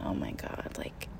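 breathless whispered panic under her breath; panicked whisper. Retold or imitated. Alone at the locked door between cars, whispering in panic. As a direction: whisper it like you're scared of being overheard. Stuck at a locked train car.

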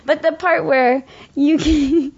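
laughing as the words come out. Real, not acted. Laughing at co-host's tease.

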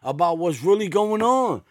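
high, incredulous rant at the TV; outraged disbelief. Retold or imitated. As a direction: pitch it up, baffled and fed up. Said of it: "I'm like".

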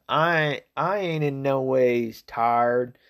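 slow, drawn-out Southern drawl; exaggerated Southern twang, stretched out slowly; put-on Southern accent. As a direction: mimic a politician's badly faked Southern accent. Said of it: "did a, a Southern accent".

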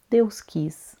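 a hushed whisper of resignation. Acted. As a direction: breathe it out, barely voiced. Grieving driver, unvoiced.

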